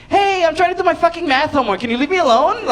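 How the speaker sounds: high, whiny brush-off; a kid snapping at a nosy adult; annoyed kid voice. Retold or imitated.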